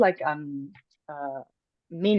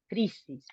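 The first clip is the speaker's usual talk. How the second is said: crisis with the force on the first syllable; stress accent; 8 dB quieter than usual. Shown as a demonstration. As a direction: lean on the "cri" of "crisis". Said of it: "with more force".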